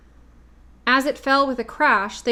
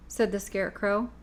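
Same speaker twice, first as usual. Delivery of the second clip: ordinary delivery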